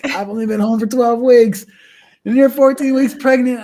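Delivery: loud, high, furious outburst; flipping out. Retold or imitated. Young husband yells at his pregnant wife in the doctor's office, a loud, high furious outburst. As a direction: blow up at her in disbelief. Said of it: "I flipped out".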